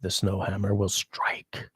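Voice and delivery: slow whispered mock threat; mock-ominous whisper of a chat threat; spooky whisper. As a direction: whisper it slowly like an ominous warning.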